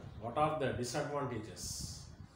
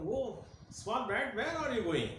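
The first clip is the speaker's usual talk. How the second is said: lion asking; 8 semitones higher than usual. Acted. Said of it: "The lion is asking".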